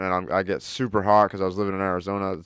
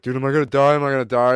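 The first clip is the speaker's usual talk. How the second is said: higher, louder mock panic; half-joking frantic plea, raised and loud; retold panic; 5 semitones higher, 6 dB louder, faster than usual. Retelling to co-host how he begged coworkers, mock panic.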